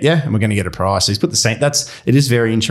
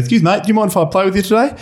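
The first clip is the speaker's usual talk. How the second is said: high, fast put-on voice of a stranger; chirpy stranger asking to join, much higher and quicker; golfer bloke voice; 12 semitones higher, faster than usual. Stranger asks to join golf.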